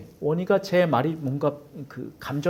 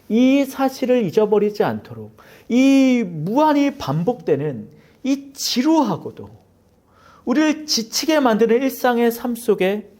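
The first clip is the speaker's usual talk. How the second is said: preaching at full force; 7 semitones higher, 4 dB louder than usual. Acted. Pastor urging the congregation not to forget, rising preaching. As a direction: lift the congregation with each phrase.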